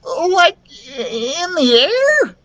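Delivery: very high, drawn-out squeak; frightened little character voice; nervous. A narrator's reading. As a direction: ask it tremulously, near tears. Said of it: "Lucas asked nervously".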